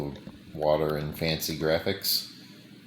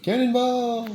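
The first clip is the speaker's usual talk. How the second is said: high, loud yell; cannonball yell; 4 dB louder than usual. Real, not acted. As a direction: shout it as you jump. Jumping in, yelling cannonball.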